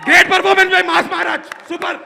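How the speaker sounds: high, loud, slow gushing praise; excited cheering. Retold or imitated. Director imitated gushing over the star's performance, ecstatic.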